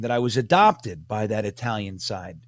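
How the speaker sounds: normal talking voice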